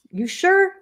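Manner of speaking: high, rising questioning lilt; doubtful question. Retold or imitated. Grown-ups asking the two-year-old, high doubtful question. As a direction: lift it high as if unconvinced. Adults questioning toddler in retold exchange.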